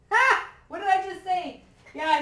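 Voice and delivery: high, loud gleeful told-you-so; very high, loud triumphant whoop. Real, not acted. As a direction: crow over being right. Card confirms her prediction.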